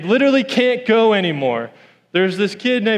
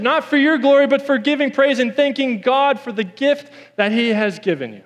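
rising preaching; 5 semitones higher than usual. Real, not acted. Preaching at full force.

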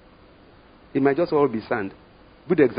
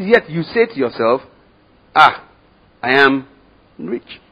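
mock boast; 4 semitones higher, 8 dB louder, slower than usual. Mocks the rich church's boast.

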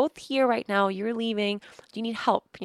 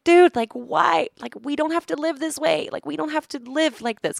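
high, loud exasperated complaint; raised voice, pissed off and fed up; retold super pissed; 8 semitones higher, 6 dB louder than usual. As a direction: re-enact pleading angrily with your husband. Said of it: "I get super pissed".

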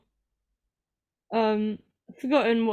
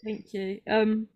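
ordinary talk, nothing marked

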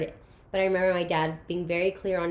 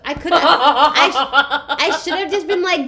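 loud, high laughter breaking through; laughing; 8 semitones higher, 12 dB louder than usual. Real, not acted. Laughing at her younger self who ran from dad, loud. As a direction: laugh it out, half-yelling. Laughing while retelling panic.

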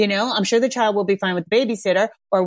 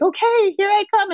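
mock sweet mom; 12 semitones higher than usual. Parent approaching upset child.

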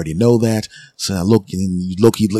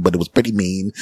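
normal talking voice

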